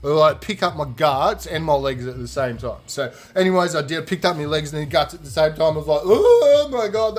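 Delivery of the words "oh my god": loud, high, drawn-out heave; disgusted groan. Retold or imitated. Picking up his guts and legs, disgusted groan. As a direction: groan it out like gagging, loud and slow. Retching in disgust while retelling.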